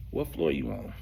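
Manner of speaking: low, sultry come-on; seductive Ron. Retold or imitated. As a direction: purr it like a flirting man. Ron voiced seductively in elevator.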